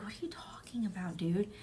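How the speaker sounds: hushed, breathy puzzlement; mock whisper. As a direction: whisper it as a baffled friend. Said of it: "everyone's like".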